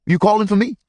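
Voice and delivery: loud, high call back. Acted. Ordy Lee answering the boy's holler, loud. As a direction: shout back across the swaying car. Shouted over moving train car.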